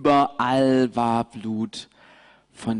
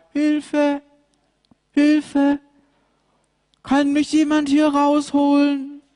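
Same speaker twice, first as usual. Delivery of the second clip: high, loud frightened cries; a stuck lamb calling out; shocked lamb crying for help; 6 dB louder than usual. Acted. Mariechen in the ditch cries for help, high and loud.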